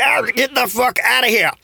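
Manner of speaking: high, strained "get the fuck outta here"; mock exasperated yelp. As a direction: squeal it out, fed up with the hot water. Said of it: "why you say it like that".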